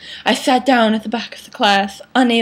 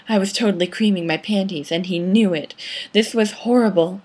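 usual speaking voice

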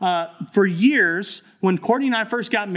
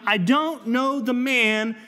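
slow, higher, mildly heated oath; swearing denial; 4 semitones higher, slower than usual. Retold or imitated. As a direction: spit out the denial as a curse. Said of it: "began to call down curses and swore".

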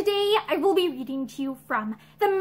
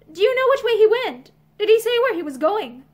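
anxious; 6 semitones higher, 5 dB louder than usual. Acted. Pansy anxious, pressing servant.

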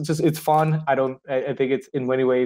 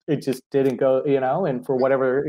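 normal talking voice

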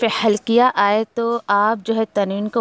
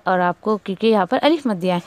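normal talking voice

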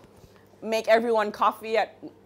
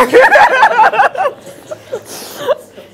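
a loud, high burst of real laughter; 11 dB louder than usual. Real, not acted.

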